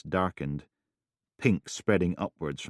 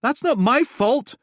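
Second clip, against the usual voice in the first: much higher and louder, defiant; 9 dB louder than usual. Acted. Helen raising her voice at Steele, defiant. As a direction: fire it back, chin up. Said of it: "voice a little louder".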